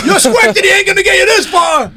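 shouted high drill-sergeant taunt; barked order. Pretend. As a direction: yell it like a boot camp instructor.

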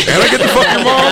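high, loud laughing mock voice; mock young boyfriend. Friend voices the nineteen-year-old in the house, high and laughing. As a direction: crack up while voicing the young guy.

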